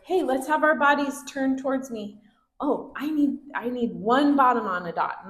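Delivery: cheeky, upbeat teacher cue; positive redirection. Shown as a demonstration. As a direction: playfully call kids to order. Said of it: "the way we wanna do it is positively".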